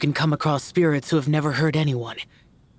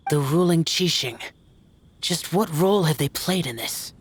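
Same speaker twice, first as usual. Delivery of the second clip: usual speaking voice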